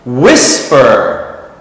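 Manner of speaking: "whisper" stressed on WHIS, falling away after it; stress on first syllable. Shown as a demonstration. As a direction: hit the front of "whisper": WHIS-per. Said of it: "It's not the same".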